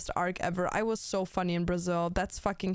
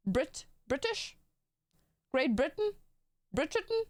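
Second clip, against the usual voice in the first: put-on British accent, rising questioning bits; playful fake British; mock British accent; 6 semitones higher than usual. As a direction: poke fun with a British accent. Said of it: "making fun of Brits".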